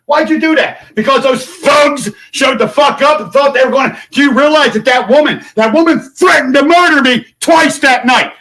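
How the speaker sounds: high, loud, outraged yelling; furious shout. Real, not acted. Demanding answers from the governor, high outraged shout. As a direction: shout it like you can't believe it. Confronting governor about arrest.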